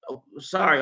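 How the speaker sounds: usual speaking voice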